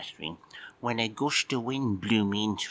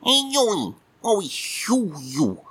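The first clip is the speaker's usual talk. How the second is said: high, squeaky grumpy cat puppet just woken; a sleepy, put-out little puppet admiral; cheeky puppet cat; 8 semitones higher, 5 dB louder, slower than usual. Acted. Puppet cat grumbling at Mike for waking him, squeaky.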